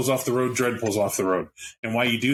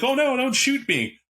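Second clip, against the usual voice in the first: high, whiny mock plea not to be shot; mock pleading suspect; 13 semitones higher than usual. GM mocks the player's surrender plea, whiny high voice. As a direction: squeak it out like a cornered guy begging the cops. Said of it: "hop out and be like".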